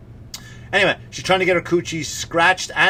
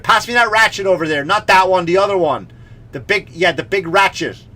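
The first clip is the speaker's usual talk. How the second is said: louder and higher call for the ratchet; calling across garage; 5 semitones higher, 7 dB louder than usual. Pretend. Comic asking a mate across the garage for the ratchet, loud call. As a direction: shout across the garage for the tool. Acted garage shout for a tool.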